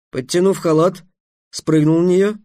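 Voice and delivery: usual speaking voice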